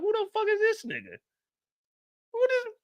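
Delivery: high, incredulous whisper-like aside; confused disbelief. Retold or imitated. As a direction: squeak it out in stunned confusion. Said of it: "I'm looking like".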